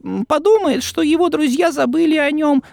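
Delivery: high, squeaky little elf voice; house-elf voice. Acted. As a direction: speak as a nervous, eager house-elf. Dobby speaking.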